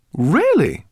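high, delighted really; pleased surprise. Acted. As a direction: brighten with surprise. Pleased professor.

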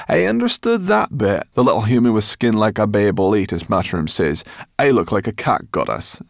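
proud little brownie boasting; flattered brownie. Acted. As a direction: show off a compliment with glee. Sorrel boasts of the compliment.

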